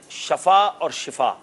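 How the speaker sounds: slow "شفا اور شفا", first with sha, then shi; "شفا اور شفا" drawn out, first vowel a, then i; contrasting two pronunciations. Shown as a demonstration. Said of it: "لوگ جو ہیں اس میں غلطی کر جاتے ہیں".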